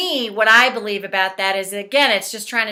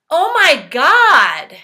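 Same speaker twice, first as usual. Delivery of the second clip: slow, loud, high outburst; venting exasperation; 4 semitones higher, 7 dB louder, slower than usual. Shown as a demonstration. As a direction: let out drawn-out exasperation. Leads angry venting during tapping.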